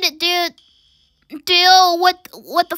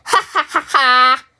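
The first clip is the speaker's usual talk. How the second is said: evil cackle; 3 semitones lower than usual. Acted. Plotting to lower fire alarms.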